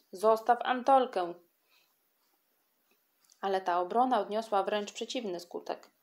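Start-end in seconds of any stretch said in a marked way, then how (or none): 0.1-1.3 higher, louder echo of the demand; the children back up the boy, calling out in chorus. Acted. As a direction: several kids chime in together. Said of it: "Kilkoro dzieci zaraz mu zawtórowało".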